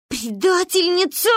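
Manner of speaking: high, wailed accusation; crying. A narrator's reading. Girl betrayed by her friend, crying. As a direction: wail it at a traitor. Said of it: "заплакала Манька".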